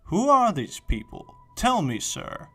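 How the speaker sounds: high, loud theatrical question; hammy stage actor. Acted. Dante's anxious question to Virgil.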